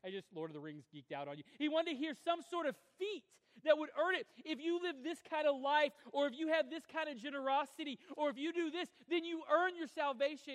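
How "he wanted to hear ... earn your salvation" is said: loud, high, driving sermon delivery; preaching at full force. Acted. Pastor to his congregation, preaching at full force. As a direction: preach it out to the whole room.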